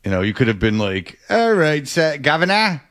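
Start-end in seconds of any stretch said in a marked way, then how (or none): 1.3-2.8 hammed-up high British greeting; mock British. Ribbing the co-host over breakfast beans, mock British. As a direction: put on a higher-pitched British accent. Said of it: "That was British".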